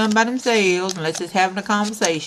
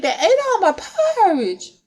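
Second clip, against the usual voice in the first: put-on high-pitched child's wail; Baby Bear; 11 semitones higher than usual. Baby Bear repeats his porridge complaint, high wail. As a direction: whine it way up high like an upset cub.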